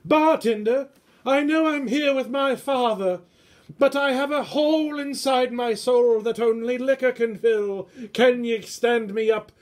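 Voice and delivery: put-on high squeaky boy asking for liquor; squeaky kid voice. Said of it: "a squeaky voice like this".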